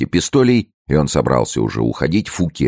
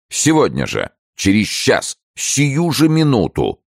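rising higher with each phrase; urgent resolve; 12 semitones higher than usual. A narrator's reading. Minister resolving to act immediately, urgent resolve. Escalating resolve to leave at once.

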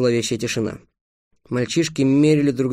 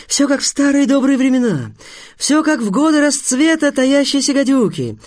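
high-pitched, mounting enthusiasm; rapturous delight; 10 semitones higher, 3 dB louder than usual. A narrator's reading. Teacher recalling the good old days of murder, high-pitched rapture. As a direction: revel in every item of the list. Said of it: "восхитился учитель Апф".

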